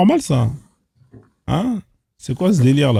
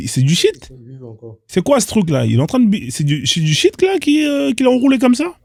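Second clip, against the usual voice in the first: ordinary talk, nothing marked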